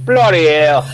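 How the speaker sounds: mock British exclamation; Britishy. Answering whether Americans say it, mock British accent. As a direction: put on a British accent for fun. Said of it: "only because it sounds Britishy".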